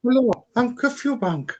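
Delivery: high put-on celebrity voice; Chris Eubank impression. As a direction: play Chris Eubank with a grand hello. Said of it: "Chris Eubank on the street".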